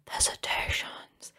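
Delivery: slow eerie whisper. Shown as a demonstration. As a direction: breathe it like a ghost. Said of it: "spooky repeat".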